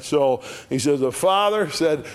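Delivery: ordinary delivery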